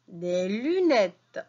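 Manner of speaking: "Des lunettes" said slowly, each syllable drawn out; slow modelling. Shown as a demonstration. Teacher showing pupils the word "Des lunettes", said slowly. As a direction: stretch "Des lunettes" out for beginners. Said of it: "teacher models word".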